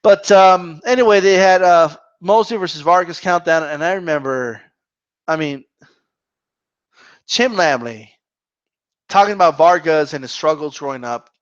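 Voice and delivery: ordinary talk, nothing marked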